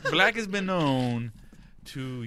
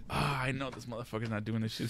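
soft, low-pitched disbelieving aside; muted dread; 4 semitones lower, 8 dB quieter than usual. Retold or imitated. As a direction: think it aloud quietly, fed up. Retold groggy thought in bed.

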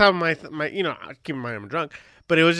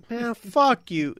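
slow, higher mock-indignant curse; retold angry curse; 6 semitones higher, much slower than usual. Storyteller repeating his curse at the bouncer, indignant. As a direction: draw the curse out, replaying your outrage.

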